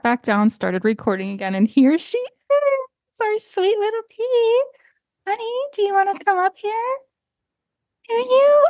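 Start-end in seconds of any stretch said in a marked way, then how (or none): 1.7-8.7 high, sing-song pet talk; cooing to the cat. Real, not acted. Talking baby-talk to her cat.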